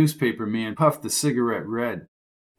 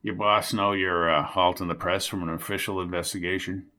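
talking round a cigarette; 6 semitones lower, 4 dB quieter than usual. A narrator's reading. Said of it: "left the cigarette in his mouth as he spoke".

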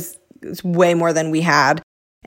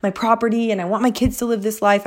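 higher, hurried, restless pleading; antsy; 5 semitones higher, faster than usual. Retold or imitated. Wife itching for a house and land, antsy. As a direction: re-enact impatient wanting, pitched up. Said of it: "I'm starting to get antsy".

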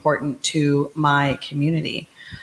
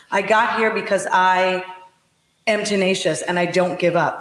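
normal talking voice

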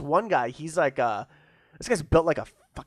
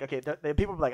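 normal talking voice

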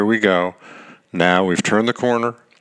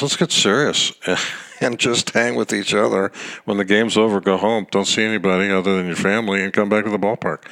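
ordinary talk, nothing marked

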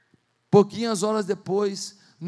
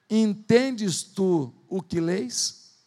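slow solemn question; 3 semitones lower, much slower than usual. Acted. Philip's question to the eunuch.

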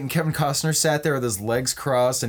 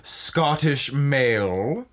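mock-sweet, stretched-out ending; ditzy, catty mean girl; 3 semitones higher, slower than usual. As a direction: draw out the last words with camp disdain. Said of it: "They're very ditzy and catty".

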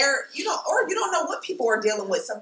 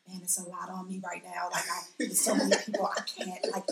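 softer, weary brush-off; mild overwhelmed mumble; 3 semitones lower, 7 dB quieter than usual. Retold or imitated. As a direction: sound swamped and tired. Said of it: "it'll be like".

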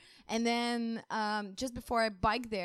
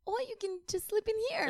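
kind church lady; 12 semitones higher than usual. Retold or imitated. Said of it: "She's like".

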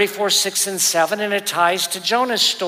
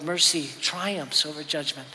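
Mercy said again much softer, almost under the breath; quiet repeat; 3 semitones lower, 8 dB quieter than usual. Shown as a demonstration. Preacher echoing his key line to the congregation, much softer. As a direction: let Mercy triumphs over judgment sink in softly.

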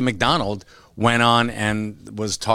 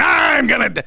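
much higher shouted outburst; shouted, much higher inner fury; inner rage shout; 4 dB louder than usual. Retold or imitated. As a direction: let the caged rage burst out. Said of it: "the little guy is in there going".

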